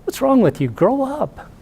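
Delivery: high-pitched imitation of an exasperated son; annoyed son. Son tells off his dad on the boat, mock annoyed, high voice. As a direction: scold Dad in a high, put-on voice. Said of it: "he's annoyed".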